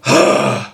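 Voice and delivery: breathy rasping exhale; a weak first scream that is mostly air; airy practice scream; beginner scream breath. Shown as a demonstration. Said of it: "you're just gonna go like".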